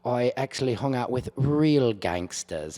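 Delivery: imitating a British actor's accent. British Snowfall actor thanking Jimmy on talk show, British accent. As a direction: put on a polite British accent. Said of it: "they can do our accents so much better".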